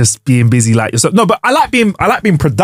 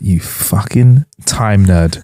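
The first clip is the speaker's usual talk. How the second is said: slow, lowered scornful jibe; slow mock contempt; mock insult; 7 semitones lower, much slower than usual. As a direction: sneer at the coworker. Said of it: "you're like".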